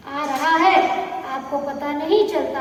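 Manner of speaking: normal talking voice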